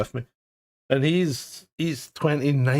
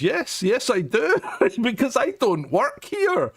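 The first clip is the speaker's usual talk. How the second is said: sarcastic retort; 8 semitones higher than usual. Retold or imitated. Retold sarcastic reply to staff.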